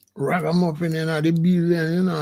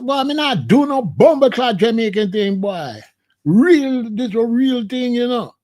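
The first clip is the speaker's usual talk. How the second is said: louder, higher Jamaican patois boast; Jamaican accent; 4 semitones higher, 4 dB louder than usual. Retold or imitated. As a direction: belt the Jamaican line with pride. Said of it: "but we say".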